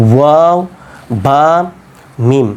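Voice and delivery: ওয়াও, বা, মিম sounded from both lips; careful isolated ওয়াও, বা, মিম, lips shaping each; two-lip articulation. Shown as a demonstration. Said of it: "তিনটা উচ্চারণ হয় দুই ঠোঁট থেকে".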